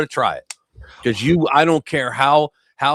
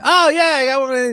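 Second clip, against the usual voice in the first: mock glee; 13 semitones higher than usual. Voicing himself finding fun.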